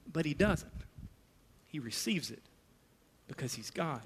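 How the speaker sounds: dropping almost to a whisper; hushed awe. Real, not acted. Pastor telling Thomas touching Jesus, hushed. Quiet conclusion after outburst.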